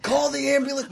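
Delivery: laughing through a high panicked plea; high mock panic. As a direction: squeal it like a hammy drunk. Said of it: "It's like".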